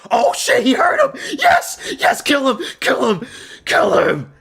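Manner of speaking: real full-throated shouting, high and loud; wild fight-fan yelling; frenzied cheering. Real, not acted. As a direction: scream your fighter on to the finish. Cheering fighter on as he hurts opponent.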